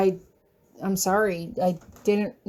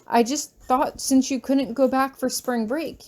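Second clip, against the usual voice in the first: plain reading voice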